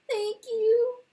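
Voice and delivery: high, loud, tearful little mouse voice; crying mouse. Acted. The sobbing mouse thanks the hen, high and crying. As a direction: squeak it out through tears.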